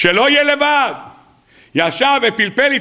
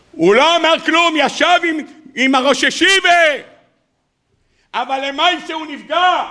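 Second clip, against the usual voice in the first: emphatic repeat; 7 semitones higher than usual. Real, not acted.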